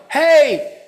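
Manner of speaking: high, loud shout imitating excited kids; acted-out child's yell; kids calling out. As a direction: holler it long and high to others far off. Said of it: "I heard these kids going".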